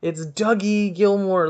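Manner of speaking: loud, high, slow, pleading. Pretend. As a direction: drag it out, insisting. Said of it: "I could have been like".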